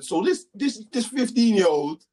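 high, emphatic disbelief; indignant challenge. Retold or imitated. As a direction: retell shaming a teacher with rising outrage. Mum challenges the teacher, retold.

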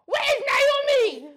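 high, loud yell for Naomi; shouting mom. Retold or imitated. Mother yelling up the stairs for her daughter, loud. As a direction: bellow the name across the house.

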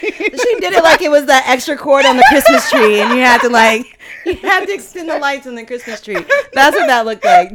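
loud, high laughing description. Real, not acted. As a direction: tell it while cracking up. Laughing hard while describing gesture.

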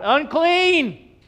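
high, loud town-crier call; acted warning shout. Leper warning the townspeople, shouted. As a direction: shout it out to clear the street. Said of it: "they had to shout".

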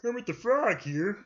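high-pitched puppet frog greeting; Kermit the Frog. Acted. Man retrying his best impression, high puppet frog. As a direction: do a high cartoon frog announcing himself. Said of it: "Let me try again".

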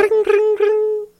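very high sing-song ring, ring; tiny high ringtone voice; ringing phone imitation.

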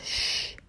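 soft unvoiced shh to silence a voice; finger-to-lips shush. Shown as a demonstration. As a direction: hush it like quieting a child. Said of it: "put your pointer finger to its mouth".

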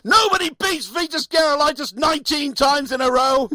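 high, cocky boast; quoting a tennis player. Retold or imitated. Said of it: "in his press conference says".

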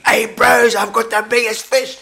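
loud, high-pitched holler; imitated excited shouting. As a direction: crow to your mates about the catch. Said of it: "And he was shouting".

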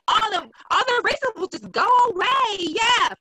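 high, loud sarcasm; mocking hopes that racism will vanish, sarcastic high loud voice; sarcastic mock cheer.

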